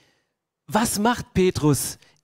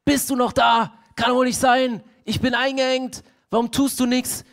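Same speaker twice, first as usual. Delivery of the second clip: rising, louder pleading with God; desperate cry to God; 6 semitones higher, 3 dB louder than usual. Retold or imitated. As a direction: cry out, feeling abandoned in prison. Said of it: "hast das Gefühl".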